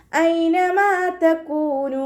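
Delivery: slow, drawn-out melodic tajweed chant; Quran recitation. Shown as a demonstration. Teacher models melodic Quran recitation for students. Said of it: "ഹംസയുടെ സൗണ്ടിനെ വ്യക്തമാക്കിയിട്ട്".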